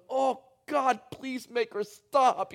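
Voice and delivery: high, laughing mock prayer; husband silently begging for the schedule talk to end, mock despair; mock desperate plea. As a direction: beg heaven to end the talk, half-laughing. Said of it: "I'm going".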